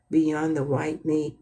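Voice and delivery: ordinary talk, nothing marked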